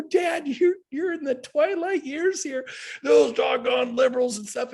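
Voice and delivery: put-on high, loud teasing voice to an old father; high mock plea to a riled-up dad; mock pleading with dad. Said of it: "I go".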